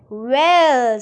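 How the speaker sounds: one loud, drawn "Well"; angry and astonished. A narrator's reading. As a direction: burst out in indignant amazement. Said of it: "in the most angry and astonished voice".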